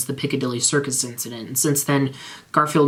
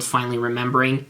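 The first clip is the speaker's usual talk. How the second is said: ordinary delivery, nothing marked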